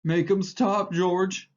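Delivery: panicked plea; terrified cry. A narrator's reading. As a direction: beg George to stop the beating. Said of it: "He cried".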